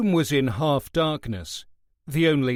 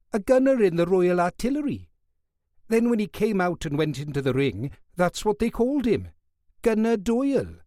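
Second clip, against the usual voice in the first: higher, quicker Welsh guard bragging about a boxer; high Welsh voice, enthusiastic; 8 semitones higher than usual. Acted. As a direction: let the Welsh fan's pride spill over.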